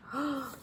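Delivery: a quick startled intake of breath; sharp gasp. A narrator's reading. Big Max stops on the trail, a sudden gasp. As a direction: gasp as something is wrong.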